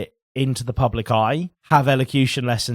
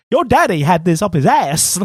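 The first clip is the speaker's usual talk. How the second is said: louder, higher film-star impression, odd cadence; Christopher Walken; 4 semitones higher, 5 dB louder than usual. Co-host quotes the Pulp Fiction watch scene, Walken impression. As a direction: punch the words in an oddly stressed American cadence. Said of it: "it's just very Christopher Walken".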